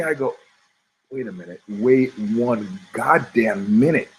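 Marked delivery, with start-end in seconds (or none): 1.1-4.1 dawning suspicion. Retold or imitated. Acting out his sudden suspicion.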